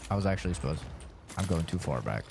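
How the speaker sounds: usual speaking voice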